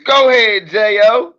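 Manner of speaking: loud, high hype call; hype shout. Real, not acted. Streamer hyping his twin, loud call.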